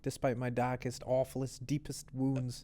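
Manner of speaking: voicing someone's wonder at being loved; relieved acceptance. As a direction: let it out as if finally accepted. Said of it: "and then it's just like".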